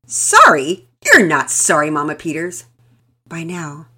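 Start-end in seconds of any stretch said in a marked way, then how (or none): 0.1-2.6 loud, indignant retort; angry. A narrator's reading. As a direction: fire it back furious. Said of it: "practically spitting nails".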